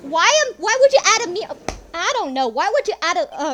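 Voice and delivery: incredulous yell. Real, not acted. Streamer yelling at the game's weird mirror choice, loud and incredulous. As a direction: shout it in disbelief.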